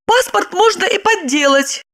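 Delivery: high-pitched, timid disbelief; babbled doubt. A narrator's reading. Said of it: "пролепетала". (лепетать высоким голосом)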